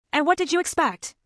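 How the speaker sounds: firm, pointed challenge; stronger tone. A narrator's reading. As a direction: push back with new firmness. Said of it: "now with a stronger tone".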